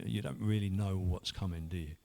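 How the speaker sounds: ordinary talk, nothing marked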